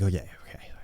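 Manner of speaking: low, near-whispered okay; hushed agreement. Retold or imitated. Dad agreeing not to tell Mom, hushed. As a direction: murmur it very softly, barely voiced. Father quietly agreeing, acted out.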